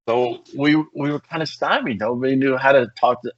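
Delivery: ordinary talk, nothing marked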